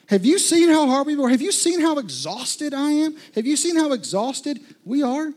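loud, high, fed-up pleading; exasperated loud complaint. Acted. Preacher voices an exhausted complaint.

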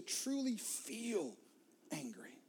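whispered; hushed emphasis. Real, not acted. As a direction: breathe the word out. Whispered sermon point.

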